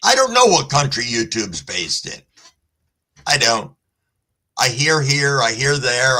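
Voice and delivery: ordinary talk, nothing marked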